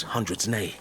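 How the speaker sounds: usual speaking voice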